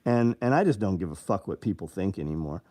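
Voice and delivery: ordinary talk, nothing marked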